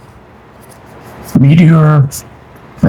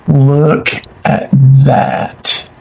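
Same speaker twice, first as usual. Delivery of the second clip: ordinary talk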